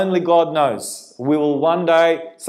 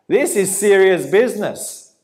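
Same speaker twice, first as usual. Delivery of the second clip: normal talking voice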